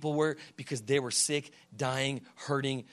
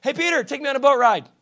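high, loud cheery call; happy Jesus; 11 semitones higher, 13 dB louder than usual. Acted. Jesus calling to Peter from the beach, happy and loud. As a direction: call out brightly, carefree and well rested. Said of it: "And then Jesus, all happy".

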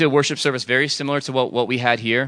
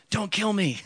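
high, softened fearful plea; mock begging; 9 semitones higher, 7 dB quieter, slower than usual. Preacher imitating someone praying in fear, mock begging. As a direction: plead small and high, hammed-up. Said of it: "it's the same voice as if you're like".

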